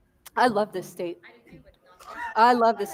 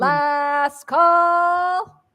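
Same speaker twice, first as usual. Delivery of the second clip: drawn-out last call; 5 semitones higher than usual. Real, not acted. Auctioneer closing the lot.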